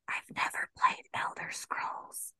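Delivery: hushed confession; whispering. Real, not acted. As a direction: whisper it like a guilty secret. Sheepish aside about never playing.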